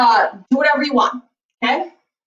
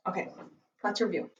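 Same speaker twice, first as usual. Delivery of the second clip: weary, much softer and lower; tired; 6 semitones lower, 14 dB quieter, faster than usual. Real, not acted. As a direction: sigh it out, worn out. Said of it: "this makes me tired".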